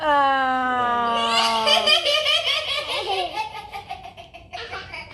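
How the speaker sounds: loud wailing laugh; loud howling laugh. Real, not acted. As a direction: howl with laughter. Loud laugh after punchline.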